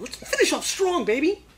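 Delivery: high-pitched burst of enthusiasm; rally the audience for a big finish; excited hype. Real, not acted. Bursts out after whispered build-up.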